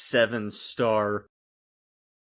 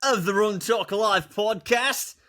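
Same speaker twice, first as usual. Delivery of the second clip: put-on playful Australian accent, pitched higher and slowed down; mock Australian accent; 11 semitones higher than usual. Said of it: "that was my best Australian impression".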